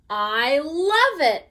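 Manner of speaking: loud, slow gloating declaration; announcing. Acted. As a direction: announce it with relish. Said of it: "he announced".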